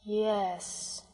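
a very slow, soft, drawn yes. A narrator's reading. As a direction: stretch the yes, lost in thought. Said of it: "still more slowly".

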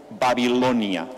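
ordinary delivery

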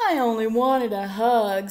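loud, mildly tearful complaint; a touch of a hurt little bear's whine; sniffly storybook lament; sniffling. A narrator's reading. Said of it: "sniffed Douglas".

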